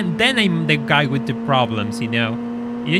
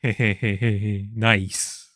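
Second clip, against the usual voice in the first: laughing; 5 semitones lower than usual. Real, not acted. Laughs at sixty-nine points.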